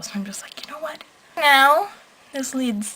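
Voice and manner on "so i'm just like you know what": hushed conspiratorial whisper; whispered aside. Real, not acted. Vlogger whispering an aside to the camera. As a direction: lean in and whisper it.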